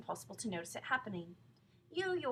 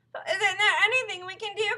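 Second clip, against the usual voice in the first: high, loud, wailing plea; desperate cry; 12 semitones higher, 14 dB louder than usual. A narrator's reading. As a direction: beg for any remedy. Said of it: "cried Missus Twit".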